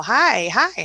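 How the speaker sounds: usual speaking voice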